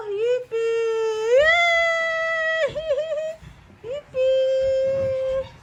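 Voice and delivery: high, loud gleeful yippee; excited cheering. Real, not acted. Celebrating lights with toddler.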